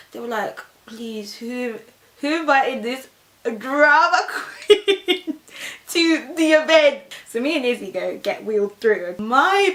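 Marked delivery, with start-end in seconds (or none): 0.9-7.0 stretched-out, laughing 'drama queen' jibe; high, slow, hammed-up complaint; mock exasperation. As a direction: draw it out loudly, laughing. Mock exasperated staff, breaking into laugh.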